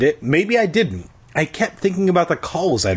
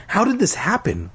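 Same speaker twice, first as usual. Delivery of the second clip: mild, higher-pitched cry of disbelief; understated dismayed outcry; 8 semitones higher than usual. A narrator's reading. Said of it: "I cried".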